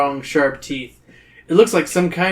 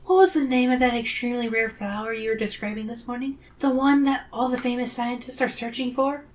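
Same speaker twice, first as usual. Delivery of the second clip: put-on high, soft innocent girl voice; Zoe; 8 semitones higher, 6 dB quieter than usual. A narrator's reading. Zoe slyly asking her teacher about a flower, innocent. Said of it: "Zoe asked innocently".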